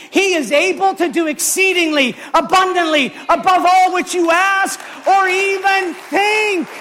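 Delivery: full-force preaching. Acted. Pastor shouting to the congregation, full-force preaching. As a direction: shout the promise to the whole room.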